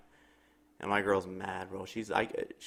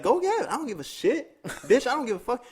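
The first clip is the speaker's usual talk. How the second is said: much higher, defiant yell; defiant angry; 13 semitones higher than usual. Retold or imitated. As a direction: dare her to get the manager. Girlfriend defiant, swearing at neighbor.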